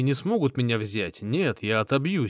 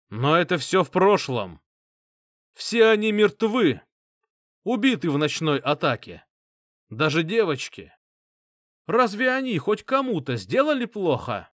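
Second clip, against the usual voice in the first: raised, higher, rousing voice; louder; 6 semitones higher than usual. A narrator's reading. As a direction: start to stir the crowd. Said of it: "сказал громче".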